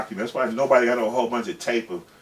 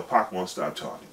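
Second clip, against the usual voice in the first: ordinary talk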